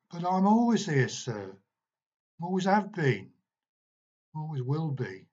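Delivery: put-on the ghost boy telling his teacher; eerie cockney boy. A narrator's reading.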